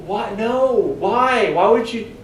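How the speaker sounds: high, pleading outburst of anger; a high, frustrated cry of why; exasperated angry why. Retold or imitated. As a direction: throw up your hands: why would you. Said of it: "I'm just so angry".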